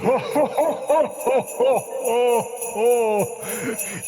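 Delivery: loud ho-ho-ho belly laugh; booming, rhythmic Santa guffaw; big rolling ho-ho-ho; jolly Santa laugh. Acted. Santa laughs at movie question.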